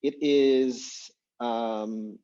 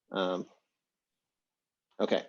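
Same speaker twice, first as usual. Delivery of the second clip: usual speaking voice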